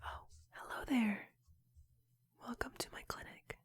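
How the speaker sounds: soft unvoiced ASMR whisper; whispered asmr role play. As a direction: whisper gently, like a calm therapist. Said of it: "Sydney's A S M R".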